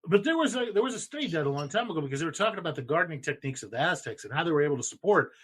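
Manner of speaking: rapid-fire, excited explaining; fast and excited. Real, not acted. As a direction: rattle it off fast, carried away by excitement. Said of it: "I'm talking really fast. I'm excited".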